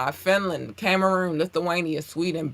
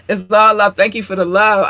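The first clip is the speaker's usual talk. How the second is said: loud gushing thanks; 5 semitones higher, 11 dB louder than usual. Acted. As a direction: say it again much louder, beaming. Repeats it louder, gushing.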